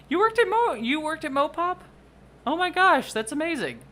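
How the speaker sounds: high, excited squeal of disbelief; amazed delight. Real, not acted. Streamer learning a viewer worked at Mopop, amazed. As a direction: squeal it, thrilled and astonished.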